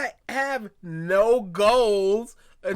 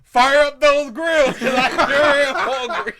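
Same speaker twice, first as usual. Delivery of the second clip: loud, raised-pitch comic bellow; mock shouted order; 4 semitones higher, 7 dB louder than usual. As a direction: holler the order, playing along. Joining in the bit of yelled orders.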